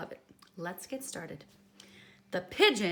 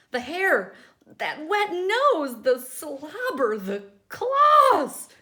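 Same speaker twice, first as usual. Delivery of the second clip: louder, rising, slow alarmed list; growing panic; 6 semitones higher, 12 dB louder, slower than usual. Acted. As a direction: build fear with each item. Pigeon listing fears.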